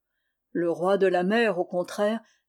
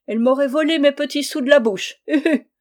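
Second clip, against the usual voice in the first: high, loud and fast rant ending in a hoot; ranting outrage; 6 semitones higher, 6 dB louder, faster than usual. A narrator's reading. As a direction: spit out the grievance. Old sailor ranting about daughters. (le vieux marin s'emporte contre ses filles, fort et vite)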